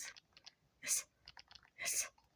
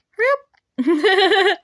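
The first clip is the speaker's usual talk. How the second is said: urgent shout; 3 semitones higher, 8 dB louder, slower than usual. Real, not acted. Urging character on loudly.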